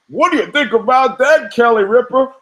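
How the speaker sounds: high, chirpy hammed-up morning TV host; mock TV talk show host. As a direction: parody a cheesy daytime TV anchor. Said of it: "the old two shots of guys".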